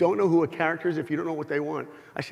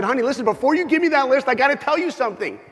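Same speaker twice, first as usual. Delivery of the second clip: much higher, louder, fast eager rush; overeager mock gush; 10 semitones higher, 6 dB louder than usual. Husband imagining cutting off his wife's list, overeager gushing. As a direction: gush like a too-keen husband. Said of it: "Can you imagine if I picked up the phone".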